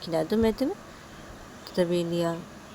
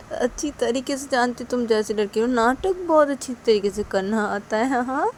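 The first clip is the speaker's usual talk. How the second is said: taunting father; 5 semitones higher, 4 dB louder than usual. Acted. Father accusing mother of play-acting, mildly taunting. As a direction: needle with a touch of mockery.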